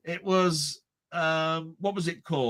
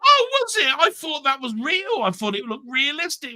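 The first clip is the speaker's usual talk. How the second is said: mock innocent friend; 8 semitones higher than usual. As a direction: play the gullible mate who swallowed it. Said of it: "And he was going".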